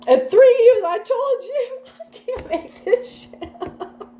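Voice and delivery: high, breaking into real laughter; cracking up. Real, not acted. Reader amused by the message coming through, cracking up. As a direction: squeal it out through helpless laughter. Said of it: "That cracks me up".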